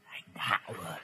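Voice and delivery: faint, low ghost whisper from outside; ghostly whisper. Acted. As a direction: breathe his name like a ghost. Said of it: "có tiếng thì thào".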